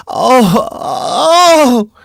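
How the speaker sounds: loud, very high wailing "oh"; appalling laughing cry. A narrator's reading. Recluse pressed to the grating, appalling laughing cry. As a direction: let out a wild, drawn-out high cry. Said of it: "she cried with an appalling laugh".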